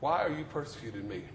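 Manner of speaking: soft low echo of the rebuke; hushed repeat. Acted. Preacher repeating heaven's question to the congregation, hushed. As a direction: let the question sink in quietly.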